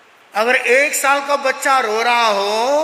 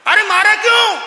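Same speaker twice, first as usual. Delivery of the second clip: indignant cry; 9 semitones higher, 6 dB louder than usual. Acted. Slap scene, indignant cry.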